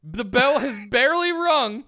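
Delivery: high, loud, relishing recap; excited hype. Real, not acted. Co-host recounting a wild death match, excited hype. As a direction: punch out each phrase with rising excitement.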